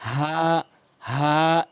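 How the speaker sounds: হা drawn out evenly from the throat; one-alif stretch. Shown as a demonstration. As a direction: hold the vowel of হা for one count. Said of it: "এক আলিফ করে টেনে টেনে".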